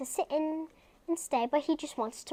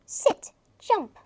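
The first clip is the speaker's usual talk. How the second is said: dog command; 7 semitones higher, 5 dB louder than usual. Acted.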